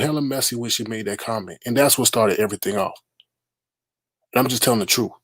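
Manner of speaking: ordinary delivery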